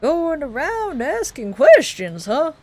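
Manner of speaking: suspicious miner. Acted.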